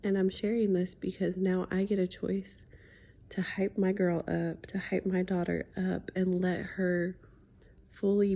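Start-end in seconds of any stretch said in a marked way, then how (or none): none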